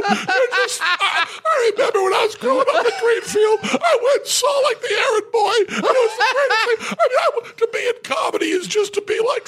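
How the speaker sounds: mock sobbing gush, high and shouted; gushing crying fan. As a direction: blubber like a starstruck kid meeting his hero. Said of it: "I cried".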